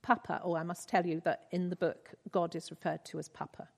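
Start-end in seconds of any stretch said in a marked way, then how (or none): none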